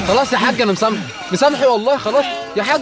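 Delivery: high, frantic pleading for mercy; panicked begging. Real, not acted. Player begging the attacking old man, panicked.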